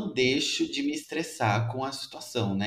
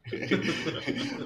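laugh; 5 semitones higher, 4 dB quieter than usual. Real, not acted. Laughing at podcast topic intro.

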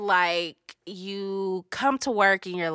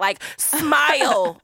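slow and louder, smile stretched out; drawn-out demand; 5 semitones higher, 5 dB louder, much slower than usual. Real, not acted. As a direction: lean on smile and draw it out. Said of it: "Crack a smile".

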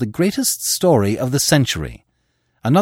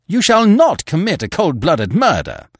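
higher and louder in fury; angry shout; 10 semitones higher, 6 dB louder than usual. A narrator's reading.

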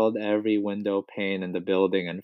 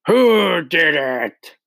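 loud, slow, high-pitched roar; furious roar; 7 semitones higher, 5 dB louder, much slower than usual. A narrator's reading. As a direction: roar it, dragging out each word. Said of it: "she roared".

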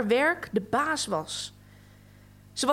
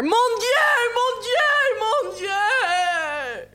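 high, loud screams of pain; a woman in labour crying out from the next room; screaming; 11 semitones higher, 5 dB louder, much slower than usual. A narrator's reading. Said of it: "schreeuwde de vrouw".

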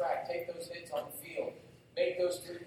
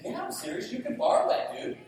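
high, louder, insisting; earnest insistence; 13 semitones higher, 8 dB louder than usual. Retold or imitated. Pastor insisting to young leader, high and loud. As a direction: insist he's serious.

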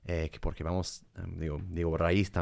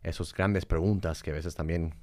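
ordinary talk, nothing marked

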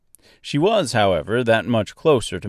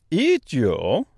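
put-on higher questioning Austrian-French accent; 5 semitones higher than usual. A narrator's reading. Giant stork to Dorothy, surprised, in Austrian-French accent. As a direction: ask in a thick mock Austrian accent with some French. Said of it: "in a strangely thick, almost Austrian accent".

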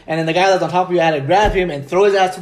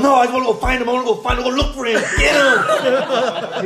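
higher-pitched mock insistence, breaking into laughter; insistent drunk friend; 6 semitones higher than usual. Friend mimicking him demanding the cops chase attackers, mock insistence. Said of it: "That's all Diego said".

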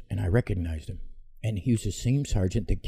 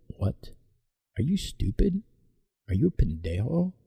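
incredulous, much higher pitch; indignant retort; 5 semitones higher than usual. Retold or imitated. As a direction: fire back in disbelief, pitch jumping up. Retold insult to funeral owner.

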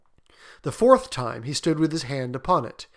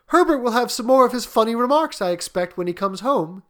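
wife's voice; 9 semitones higher, 6 dB louder than usual. A narrator's reading. Wife voiced in read story.

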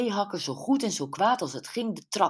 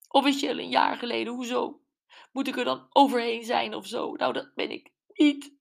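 fast, higher, defensive retort; defensive Gwen; 7 semitones higher, 3 dB louder, faster than usual. A narrator's reading. Gwen snapping back at Brenda about the divorce, defensive. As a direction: bristle and rush the answer.

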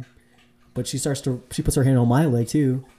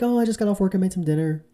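lightly voiced woman, pitched up; a hint of a cheerful hostess, higher; high mock woman's voice; 6 semitones higher than usual. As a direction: pitch up to play her offering dinner. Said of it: "She's like".

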